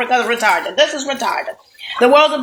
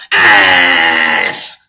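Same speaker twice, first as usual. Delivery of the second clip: shouting; 5 dB louder than usual. Real, not acted. Ranting at listeners.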